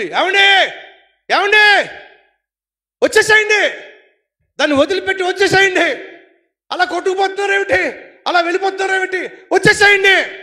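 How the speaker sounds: high, loud, desperate calling; wife shouting. Acted. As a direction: shout to the husband from the bank. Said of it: "గట్టిగా అరుస్తుంది". (ఒడ్డు నుంచి భర్తను గట్టిగా పిలిచే భార్య)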